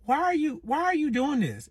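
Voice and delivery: high-pitched, disbelieving challenge; incredulous questioning. Retold or imitated. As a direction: question him in a high, baffled voice. Retold confrontation with vengeful ex.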